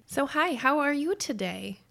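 normal talking voice

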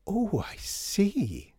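very slow, high drawn-out realisation; slowly realising. Acted. Customer grasping what is meant, slowly realising. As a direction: stretch it as it dawns on you. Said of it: "slowly realising what she meant".

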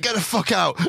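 high, put-on order to get out; mock angry order. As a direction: throw him out of the car, hammed up. Ordering the man out.

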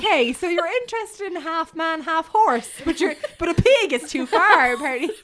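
high, loud, incredulous retort; scandalised retelling, pitched high and loud; mock incredulity. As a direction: raise the voice in mock disbelief. Retells own mock-incredulous reply to listener.

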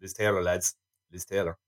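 low, hushed bragging; quietly acted-out showing off; mock boast to mates. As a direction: drop the name low to the lads. Imagined boast to old friends.

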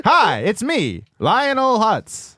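very slow, rising cartoon introduction; Phil Hartman. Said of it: "What's Phil Hartman sound like?".

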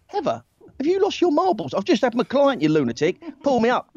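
high-pitched, incredulous mock outrage; indignant acted telling-off; aggressive confrontation. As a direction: tear into her as if she's mad. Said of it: "if that's me now".

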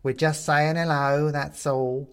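high, slow would-be soothing skeleton; calm him like a creepy old bone; eerily soothing skeleton voice. A narrator's reading. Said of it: "probably supposed to be a soothing tone".

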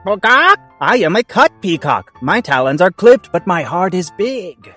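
high, loud bird voice; squawk, then plead your case in a high birdlike voice; squawking peacock. Acted. Cut peacock presents its big heart, high squawky voice.

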